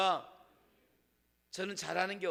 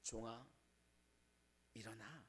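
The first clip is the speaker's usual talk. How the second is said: much softer and lower, tender call; God softly waking Elijah, tender and hushed; gentle comfort; 11 semitones lower, 21 dB quieter than usual. Acted. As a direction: coax a collapsed servant up gently. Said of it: "위로하시면서요".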